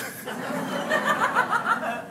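a real burst of high laughter. Real, not acted. Laughs at own joke.

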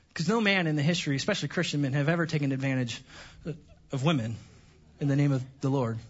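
deadpan sarcasm, said low and straight; sarcastic. Shown as a demonstration. Pastor mocking men who abuse scripture, sarcastic. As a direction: let the irony drip under a straight face. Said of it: "it was dripping in sarcasm".

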